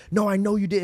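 retold heated accusation. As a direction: accuse him in a mildly acted quote. Retold friend accusing him.

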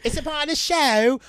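high mock Cockney voice; hammed-up Cockney question; Cockney accent. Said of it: "a Cockney British".

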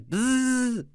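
high buzzing noise; imitated buzz. Mimicking Ruby Rhod's buzz, high-pitched. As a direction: buzz like a frantic radio host. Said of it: "remember the bzzz".

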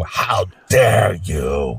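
low, slow, dragged-out outrage; Greta Thunberg voiceover. Retold or imitated. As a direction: stretch each word in mock disgust. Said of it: "The whole voiceover thing".

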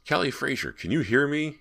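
loud call, pitched well up. Acted. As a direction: call out to someone unseen. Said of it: "he called".

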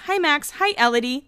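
all casual. Retold or imitated. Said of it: "Like, all casual".

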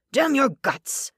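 put-on a furious drunk spitting out a curse; drawn-out angry snarl. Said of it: "he snarled".

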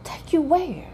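high, puzzled take you where; baffled high question back; high disbelieving query; incredulous. Retold or imitated. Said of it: "I'm like".

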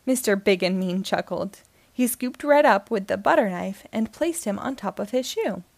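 plain reading voice

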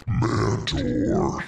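drawn-out announcer name in an altered voice; redone announcer read. Shown as a demonstration. Host redoing the botched name, announcer voice. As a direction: stretch the name out grandly. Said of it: "Let me redo that one".

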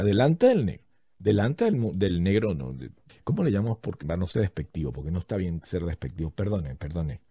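normal talking voice

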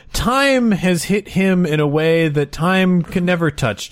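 usual speaking voice

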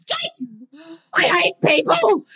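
high, drawn-out whine of dismay; mock distressed wail. Wailing to Jake about the neighbourhood Facebook page, mock distressed. Acting out dismay at phone abuse.

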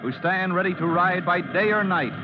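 usual speaking voice

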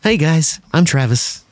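usual speaking voice

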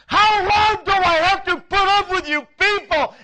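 loud, high-pitched exasperated shout; full-voiced outburst of frustration; frustrated yell. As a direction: explode at the crowd, out of patience. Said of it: "in his frustration, he yells at the people".

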